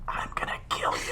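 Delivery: raspy whispered bird-like threat; voiceless croaked threat, played for laughs; menacing raven call. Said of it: "It's a mix between caw and".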